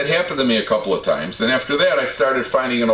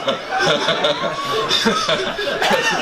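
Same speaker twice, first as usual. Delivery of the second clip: a long, high burst of real laughter. Real, not acted. Laughing at his own punchline.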